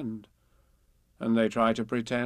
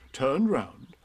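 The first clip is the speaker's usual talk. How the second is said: ordinary delivery, nothing marked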